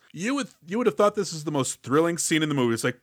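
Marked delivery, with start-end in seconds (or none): none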